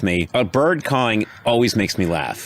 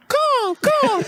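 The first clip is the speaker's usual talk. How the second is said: very high bird squawk; high-pitched mock caws; cawing bird. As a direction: imitate a bird in the background. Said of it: "in the background you hear".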